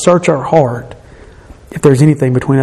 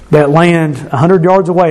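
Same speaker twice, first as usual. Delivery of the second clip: ordinary talk, nothing marked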